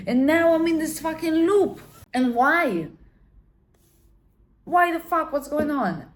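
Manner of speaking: loud high frustrated rant; exasperated outburst. Retold or imitated. Woman raging at her bad luck, loud and high. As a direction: vent it, fed up.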